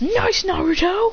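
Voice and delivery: high, drawn-out praise; hammed-up approving shout; cheering a teammate. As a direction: call out high across the fight.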